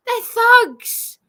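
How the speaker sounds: loud, high, bitter outburst; ranting about the police; angry shout. Real, not acted. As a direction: fling the word out in fury.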